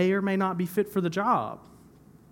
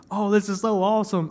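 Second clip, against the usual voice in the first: playful mock-excited exclamation, louder; mock excitement; 6 semitones higher, 6 dB louder than usual. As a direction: ham up a cheerful gasp. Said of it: "not really like a".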